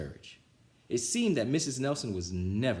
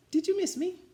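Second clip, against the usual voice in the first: sweet teacher; 3 dB louder than usual. A narrator's reading. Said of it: "she asked".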